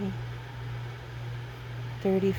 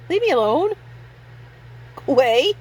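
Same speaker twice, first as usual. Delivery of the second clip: irritated brush-off; 8 semitones higher, 4 dB louder than usual. Retold or imitated. Shooing off the pestering teen.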